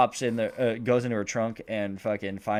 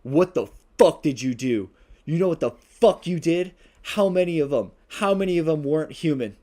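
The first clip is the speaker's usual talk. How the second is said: loud, high furious questioning; hammed-up angry interrogation; angry demand; 6 semitones higher, 7 dB louder than usual. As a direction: demand answers like a cornered demon. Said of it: "She's like".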